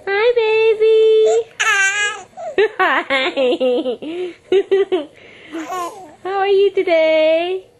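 high, slow, stretched coo. Real, not acted. Cooing at baby.

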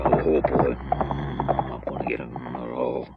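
deep, low grumble; a grouchy character grumbling under his breath; grumpy low mutter. Acted. Grumpy character eyeing Sam, low grumble.

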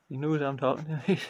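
ordinary delivery

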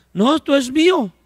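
high, possessive voice of a stingy man; mocking a possessive owner. Preacher voicing a stingy brother guarding his beds, high possessive whine. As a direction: play the stingy brother clinging to his things.

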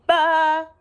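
high, loud bleat; sheep's baa. A narrator's reading. Sheep greeting the little truck, high loud baa. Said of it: "She said".

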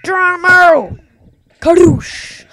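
loud, high launch shout; catchphrase yell. Real, not acted. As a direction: yell the catchphrase as the bird flies. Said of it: "It's my thing".

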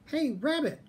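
high-pitched call through a door; mild yell. A narrator's reading. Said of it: "someone yelled outside".

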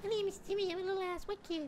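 put-on squeaky high little boy voice; mock him as a tiny kid, very high. Mocking friend as little kid.

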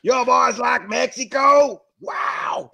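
slow, high American Southern holler; mock American accent. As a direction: draw it out like a caricature American. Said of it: "speak like Americans".

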